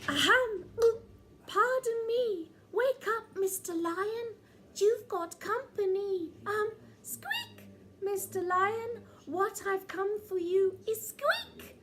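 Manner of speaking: high timid little mouse. Acted. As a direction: nervously ask the giant lion. Timid mouse addresses lion.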